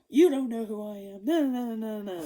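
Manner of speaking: imitating an angry friend's protest; retold angry comeback, lightly acted; half-hearted indignant objection; mock angry retort. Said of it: "very angry at me".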